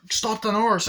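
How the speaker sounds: high, loud mock yell; retold alarmed shout. Characters calling to stop the nurse, mock shout. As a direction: call out as if raising the alarm. Acting out alarm in film scene.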